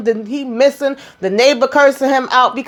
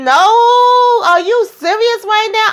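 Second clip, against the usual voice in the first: high, shocked outburst; disbelief; 13 semitones higher, slower than usual. Retold or imitated. Host reacting to Gary playing games, high shocked disbelief. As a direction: squeal it like you can't believe it.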